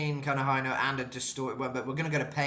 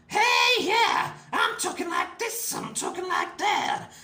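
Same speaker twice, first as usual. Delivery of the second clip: high, loud rock-singer voice; piercing high register, punched out; non-chesty high voice; 8 dB louder, slower than usual. Shown as a demonstration. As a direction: talk up in a screechy metal head voice. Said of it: "it's not like a chesty voice".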